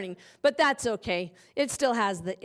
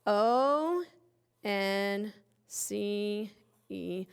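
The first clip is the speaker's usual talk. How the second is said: slow spelling; 2 semitones lower, much slower than usual. Shown as a demonstration. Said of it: "slow spelling".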